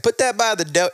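a Southern lady saying dough; imitating a Southern lady. Pizza coworker's thick Southern accent, mock imitation. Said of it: "she would always say".

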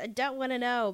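worked-up protest; mock protest. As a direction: act out shutting out spoilers. Retold resisting trailer spoilers.

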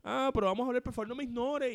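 put-on high, loud drunken begging; drunk girl pleading. Coworker drunk at a party begging him to talk, high loud pleading. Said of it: "me pararon un party borracha".

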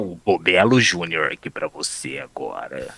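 deep voice. Acted. [name] offering a Bobelo Júnior bit, deep voice. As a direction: drop the voice deep like a TV host. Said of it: "com uma voz grossa aqui pra você".

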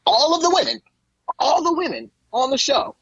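very high-pitched, exasperated emphasis; incredulous outrage. Real, not acted. Co-host appalled by the plot, high and incredulous. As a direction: hit it high, in disbelief.

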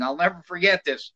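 ordinary delivery

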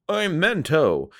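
high, drawn-out self-announcement; Mento. Acted. Quoting the hero Mento on the show, high and stretched out. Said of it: "And Mento's like".

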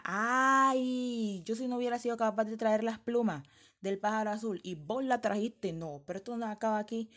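high, loud, outraged cry; furious old woman. Acted. Old woman rages at the girl who brought the feathers, loud high outrage. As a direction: spit out your rage that she managed it.

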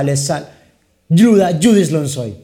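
slow, halting intro then dead air; awkward sales call opener said far too slowly; dragging wrong take. Shown as a demonstration. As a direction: stretch out the name and stall. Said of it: "Me quedo mudo".

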